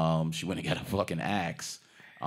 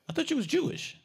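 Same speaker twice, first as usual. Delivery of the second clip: lightly raised pitch, a mock lady's voice; mock woman voice; faster than usual. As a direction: toss it off in a high put-on voice. Retold woman's reply.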